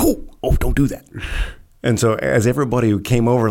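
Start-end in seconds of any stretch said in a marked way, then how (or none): 0.0-1.1 alarmed doc. Retold or imitated. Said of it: "he's like".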